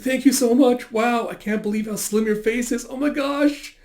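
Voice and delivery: high, giddy fangirl voice; gushing fan. Acted. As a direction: gush breathlessly over an idol. Acted fan gushing at her idol.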